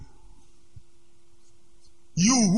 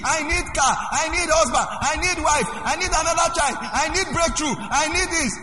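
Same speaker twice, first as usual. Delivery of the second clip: full-force preaching; 9 semitones higher than usual. Acted. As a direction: proclaim it to the whole church.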